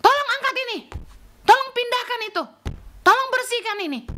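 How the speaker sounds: high, loud barked commands; imitating someone ordering people around; bossy orders. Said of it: "yang melayani itu dengan perintah-perintah".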